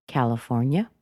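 CALIFORNIA rising on the last syllable; friendly, inviting lift at the end of "California"; casual rising intonation. Shown as a demonstration. Answering where are you from, casual upward intonation. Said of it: "went up in intonation".

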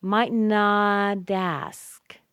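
exaggerated slow link in "not ask". Shown as a demonstration. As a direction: stretch "not" and glide into "dask". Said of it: "The T sounds like a D there".

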